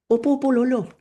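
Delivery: put-on high-pitched voice of a child; eager grandchild. Grandchild agreeing to grandfather's offer, high eager child voice. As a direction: answer grandpa as an eager little one.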